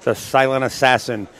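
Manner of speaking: loud, projected match-result announcement; sports announcer wrap-up. Real, not acted. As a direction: announce the winner and credit the booth, loudly. Announces result and thanks the booth.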